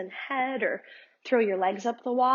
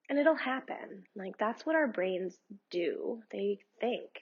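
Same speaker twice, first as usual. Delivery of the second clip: calm, unhurried meditation guide voice; guided meditation; 3 dB quieter than usual. Acted. Yoga teacher guiding her students through meditation, calm and unhurried. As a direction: lead the listener gently through breathing.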